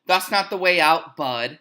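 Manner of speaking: loud, curt imitation of her; mock mean. Mean girl corrects the driver leaving, mock hostile. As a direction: throw it out loudly, mimicking her meanness. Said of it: "she was already mean".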